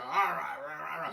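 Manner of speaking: loud mock bulldog-woman bark; imagined bulldog boss. Imagined studio executive storming out, blustering bulldog growl. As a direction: bluster like a cartoon tough boss. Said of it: "bulldog woman with a cigar is gonna come out".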